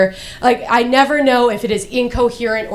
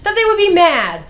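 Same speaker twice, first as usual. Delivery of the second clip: very high, indignant comic outburst; exasperated protest; 10 semitones higher than usual. Acted. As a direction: protest at the top of your pitch. Acted protest to the doctor.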